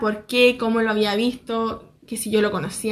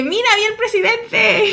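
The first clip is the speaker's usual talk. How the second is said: high, far-off mock-excited squeal; excited boast; 9 semitones higher, 3 dB louder than usual. Vlogger imagining telling Johnny she saw the president, excited. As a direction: squeal the news like a starstruck fan.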